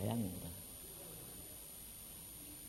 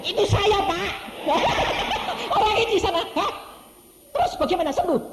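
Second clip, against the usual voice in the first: high, loud surprised exclamation; excited shout; 9 dB louder than usual. Retold or imitated. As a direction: blurt it out amazed. Orang yang sembuh berseru. (seruan kaget, tinggi dan keras)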